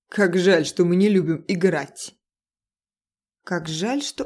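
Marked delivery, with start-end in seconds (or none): 0.1-2.1 taunting thug, louder; mocking. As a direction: sneer at the hiding man. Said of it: "насмешливый голос Тейна".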